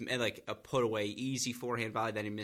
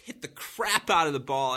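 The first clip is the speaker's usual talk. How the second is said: normal talking voice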